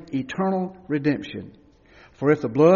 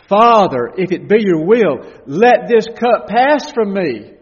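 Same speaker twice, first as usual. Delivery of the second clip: raised, pleading cry to the Father, slowing at the end; loud anguished plea; 5 semitones higher, 10 dB louder than usual. Acted. Preacher voicing Jesus crying to his Father, loud anguished plea. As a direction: cry out begging to be spared.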